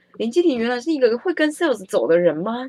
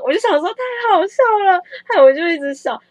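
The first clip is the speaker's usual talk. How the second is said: amused; 11 semitones higher, 5 dB louder than usual. Real, not acted. Laughing at the absurdity.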